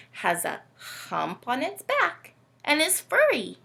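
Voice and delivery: ordinary talk, nothing marked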